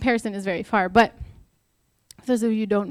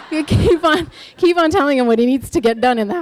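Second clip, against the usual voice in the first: loud, laughing mock encouragement; real amused laughter; 6 semitones higher, 10 dB louder than usual. Real, not acted. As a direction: talk loudly through your own laugh. Laughing at her own nagging.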